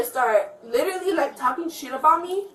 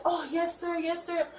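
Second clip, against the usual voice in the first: higher, softer imitation of polite compliance; mock deference; 3 semitones higher, 8 dB quieter than usual. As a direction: put on a meek, obliging yes-man voice. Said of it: "talking to your guys".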